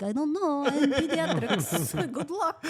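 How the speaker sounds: imagined mom, loud and high through laughter; laughing impression of a helpless parent; clueless mom. Mom asked for medical advice, laughingly clueless. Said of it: "She'd be like".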